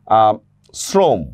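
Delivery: normal talking voice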